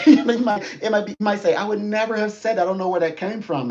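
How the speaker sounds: high, loud, laughing disbelief; giggly mock astonishment; laughing surprise. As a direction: blurt it out through laughter, amazed. Laughing, quoting surprised listener.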